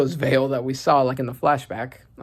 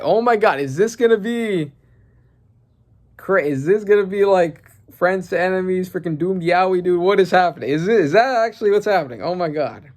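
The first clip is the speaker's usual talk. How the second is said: higher, agitated excitement; amazed disbelief; 5 semitones higher, 4 dB louder than usual. Real, not acted. Fan reacts to enemies maybe being friends, amazed. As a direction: gush in rising disbelief.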